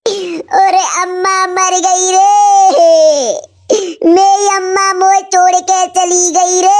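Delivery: slow, drawn-out mock lament for a dead mother; mock wailing. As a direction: wail it like a hammed-up mourner. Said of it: "तू रो रहा है?".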